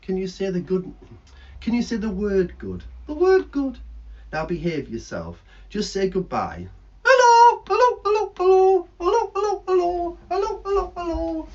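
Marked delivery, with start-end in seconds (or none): 3.0-3.8 a lightly high bird voice; lightly voiced bird puppet. 7.0-11.5 high, loud repeated squawks, fading; squawking parrot hellos. Acted.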